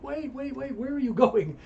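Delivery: lightly voiced girlfriend begging him to stop; girlfriend pleading. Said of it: "she's like".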